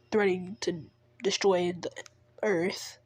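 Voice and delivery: usual speaking voice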